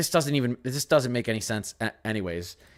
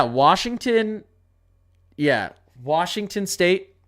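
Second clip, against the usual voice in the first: slow drawn out; 6 semitones higher, 3 dB louder, much slower than usual. Real, not acted. Viewer asks for Washington State, host answers with a slow drawn-out name. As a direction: stretch out Washington slowly, higher. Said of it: "Can you do Washington State, please?".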